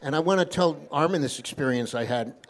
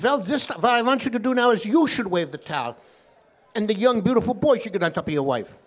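put-on higher, emphatic rabbi with a Yiddish accent; 6 semitones higher, 4 dB louder than usual. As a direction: pitch up and instruct with a Yiddish lilt. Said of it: "This is my Yiddish accent".

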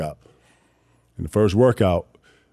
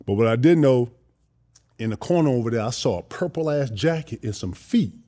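ordinary talk

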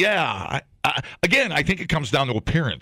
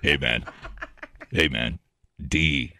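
deep radio DJ voice; 13 semitones lower, 4 dB quieter than usual. Pretend. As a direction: play a cheesy late-night radio host. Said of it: "Why are you talking like this?".

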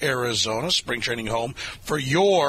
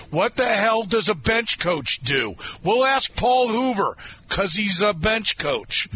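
higher, slow, drawn-out radio tease; mock-urgent hype; 7 semitones higher, slower than usual. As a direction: play up the big question with mock urgency. Hyping the interview with mock-urgent question.

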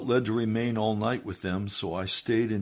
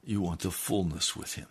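ordinary talk, nothing marked

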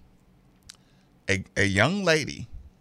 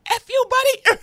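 high falsetto mock of a rude driver; mock driver's insult; 4 dB louder than usual. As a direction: snap it like a furious driver. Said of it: "And now she's passing out".

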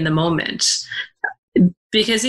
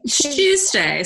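imitated British accent, high and drawn out; slow, high-pitched mock British; 9 semitones higher, slower than usual. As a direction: put on a playful British accent. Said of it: "perfecting my British accent".

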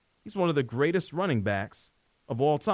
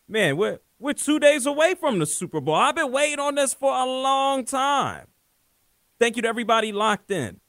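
hyped up; 11 semitones higher, 6 dB louder than usual. Real, not acted. Hyped about the Super Bowl.

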